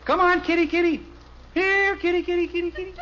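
high sing-song kitty call; coaxing a cat. Acted. Dad coaxing a cat off the garage roof, high sing-song call.

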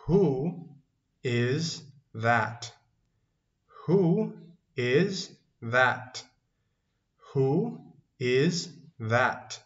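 drawn-out words with gaps between; slow teaching model. Shown as a demonstration. Teacher models an English question for learners, slow and separated. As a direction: say each word separately for repetition.

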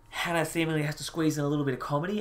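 ordinary talk

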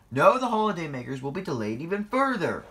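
loud, high scolding; stern. A narrator's reading. Boss telling off the engines over delays, sternly. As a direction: lay down the consequences loudly. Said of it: "he said sternly".

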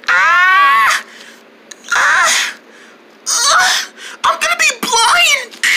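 high screaming in panic; panicked screaming. Acted. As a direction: scream like you're blinded. Blinded by flashlight, screaming.

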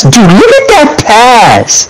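high, slow, stretched-out awe; drawn-out excited. Real, not acted. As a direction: drag it out, amazed at the pass. Teammate admiring a replayed pass.

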